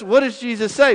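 normal talking voice

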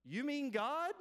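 high, rising disbelief; acted startled double question; astonished question. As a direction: ask it amazed, pitch climbing. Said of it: "Jack's reply as he's hanging".

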